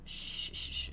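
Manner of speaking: soft unvoiced shushing; hushing. Mother calming her wailing baby, soft shush. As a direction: shush a crying baby gently. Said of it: "she hushes".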